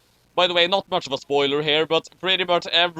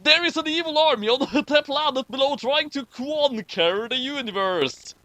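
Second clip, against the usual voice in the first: higher, hammed-up announcer read; over-the-top trailer narrator; dramatic narrator voice; 11 semitones higher than usual. Reading fanfic aloud, dramatic.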